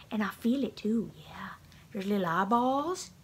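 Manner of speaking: hushed, half-whispered cooing; soft pet talk. Real, not acted. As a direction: coo quietly to a cat. Cooing softly to cat with toy.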